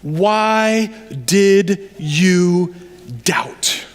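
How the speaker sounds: very slow, drawn-out, deliberate reproach; grave questioning. Acted. Jesus looking Peter in the eyes, grave drawn-out questioning.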